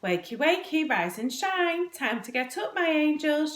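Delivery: high, singsong motherly call; bright wake-up call. A narrator's reading. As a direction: call the children awake brightly, drawing out the words. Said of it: "she called out brightly".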